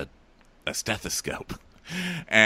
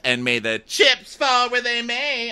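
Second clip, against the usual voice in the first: much higher, slow, drawn-out chirp; mock chipper. Co-host voicing a contestant staying positive, mock chipper. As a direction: imitate her relentless positivity, high and stretched. Acting out her forced positivity.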